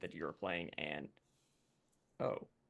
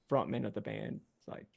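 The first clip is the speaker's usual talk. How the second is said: ordinary talk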